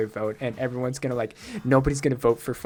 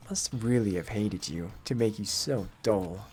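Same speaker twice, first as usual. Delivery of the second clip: slow, slightly lowered, condescending voice; aloof, scornful game character; 5 semitones lower, 5 dB quieter, slower than usual. Acted. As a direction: deliver the insult slowly and coolly. Low figure voice insulting in game.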